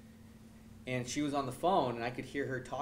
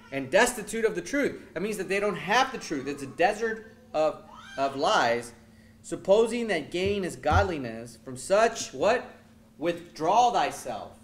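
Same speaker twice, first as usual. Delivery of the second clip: full-force preaching; 4 semitones higher, 6 dB louder, slower than usual. Acted.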